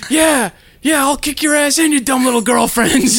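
high, loud mock shout; hammed-up furious dad shouting; imitating dad's shouted threat. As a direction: challenge the kid to pull over. Dad taunting after throwing bag.

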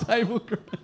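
Table grapes through a laugh. Shown as a demonstration.

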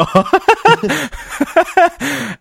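long high-pitched laughing fit. Real, not acted. Laughs hard.

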